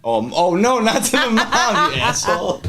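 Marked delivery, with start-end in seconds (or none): 1.9-2.7 high laughing retort. Real, not acted. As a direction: laugh through the insult. Teasing laugh at friend's joke.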